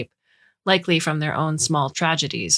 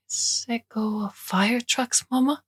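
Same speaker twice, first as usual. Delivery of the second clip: put-on little boy asking mama, slowly and high; small child; 7 semitones higher, slower than usual. A narrator's reading. Toddler in the pram asking mama about fire trucks, small high child's voice.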